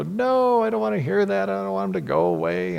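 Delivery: voicing the wife, high and pleading; pleading wife's no, pitched well up; wife's protest. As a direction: play the upset wife who can't let go. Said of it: "And she'd go".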